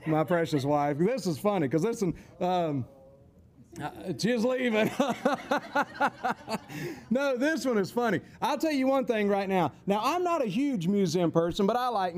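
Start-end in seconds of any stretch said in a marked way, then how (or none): 5.0-7.0 real, rolling run of chuckles; amused, extended giggling fit; long laugh. Real, not acted. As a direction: burst out laughing helplessly. Laughs as wife leaves.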